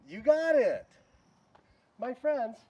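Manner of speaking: loud, high cheer; excited praise. Real, not acted. Teacher cheering the class for solving it. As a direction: cheer the right answer loudly.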